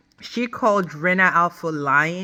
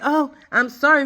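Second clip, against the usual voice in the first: lightly mocking, higher-pitched apology; fake apology; 6 semitones higher, 3 dB louder than usual. Cast member hands out a fake apology, mildly mocked. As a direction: imitate an insincere sorry. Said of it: "they give you these fake apologies".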